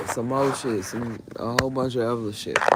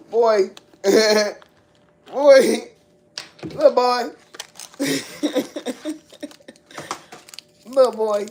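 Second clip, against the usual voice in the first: laughing call; 9 semitones higher, 8 dB louder than usual. Real, not acted. Calling out to the boy, laughing.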